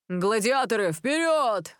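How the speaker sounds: battle cry rising high on the last word; rallying shout. A narrator's reading. Ken leading the students onto the field, shouting. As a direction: send the team charging, pitch soaring. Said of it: "крикнул Кен".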